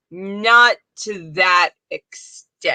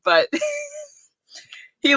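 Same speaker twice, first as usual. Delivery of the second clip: ordinary talk, nothing marked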